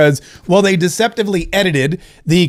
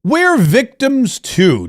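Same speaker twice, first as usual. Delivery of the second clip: mocking whine; 6 semitones higher, much slower than usual. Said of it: "Ilhan Omar is trying to say".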